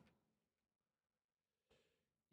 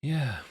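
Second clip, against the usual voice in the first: loud, high strained grunt of effort; 9 semitones higher, 8 dB louder than usual. Real, not acted. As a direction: push out a sharp grunt while working. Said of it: "Sorry, I keep grunting".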